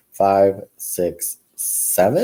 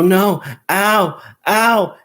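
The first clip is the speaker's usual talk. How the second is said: pained cries; 10 semitones higher, 4 dB louder, much slower than usual. Retold or imitated. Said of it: "I go".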